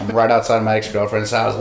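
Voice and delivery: ordinary talk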